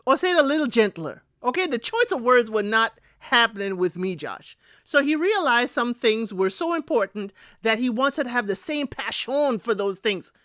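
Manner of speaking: ordinary talk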